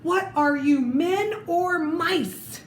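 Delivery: high, slow taunting mouse voice; scolding squeaky chief. Acted. Mouse chief scolding his fleeing troops, high and slow. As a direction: shame the troops into coming back.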